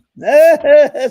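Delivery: very loud, high-pitched laughing cry; loud high outburst. Retold or imitated. Retelling the reaction to the book on the plane, loud high laughing cry. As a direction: burst out high and loud, drawn out.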